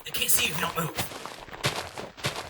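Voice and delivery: hushed movie quote. Retold or imitated. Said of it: "kinda like the T-Rex, you know, from, like, Jurassic Park".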